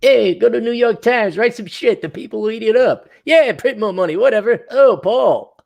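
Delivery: high-pitched mock economist voice; Krugman. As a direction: mockingly play a careless, blustering pundit. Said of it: "that's what Toothpick Pro-, Krugman says".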